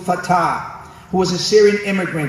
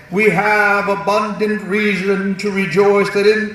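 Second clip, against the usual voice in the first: put-on slow, stately old-time oratory; founding father voice. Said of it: "that's my founding father's voice".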